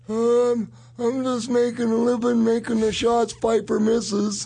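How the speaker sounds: high-pitched, drawn-out wrestler voice; mock a colleague in a high drawl, stretching the end; Terry Funk. Wrestler mocking the man who took his place, high impression. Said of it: "They said to Terry Funk".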